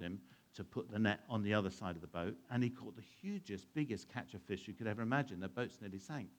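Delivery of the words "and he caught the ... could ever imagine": usual speaking voice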